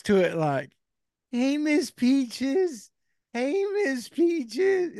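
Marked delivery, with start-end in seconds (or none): 1.3-5.0 put-on high, slow baby talk to a dog. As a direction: coo at the dog in a sing-song. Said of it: "he does the dog voice".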